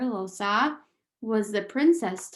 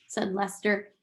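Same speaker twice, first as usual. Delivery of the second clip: usual speaking voice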